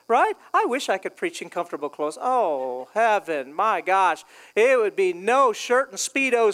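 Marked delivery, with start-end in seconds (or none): 2.2-4.2 low, dreamy sigh of longing; mock bliss. Preacher wishing for comfortable preaching clothes, mock bliss. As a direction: drop the pitch and savor the thought.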